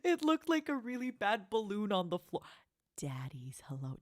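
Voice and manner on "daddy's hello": hushed unvoiced whisper. Real, not acted. Streamer whispers as her dad greets her, keeping quiet. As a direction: whisper so Daddy is not disturbed. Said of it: "Chat, quiet".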